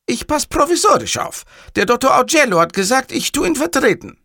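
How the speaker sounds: high, earnest underling; a very high-pitched, self-important stand-in; hapless caller Cattarella. Acted. Cattarella tells the boss he is deputizing, high and earnest.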